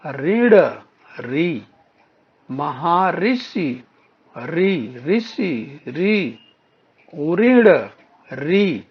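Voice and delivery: each word slowly, then its "री" alone, held long; isolating shared sound. Shown as a demonstration. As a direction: pick out "री" after each drawn-out word. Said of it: "क्या समान ध्वनि में आ रहा है".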